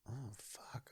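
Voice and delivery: retold soft, under-the-breath swear; hushed stunned reaction. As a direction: let it out very softly, as if it just sank in.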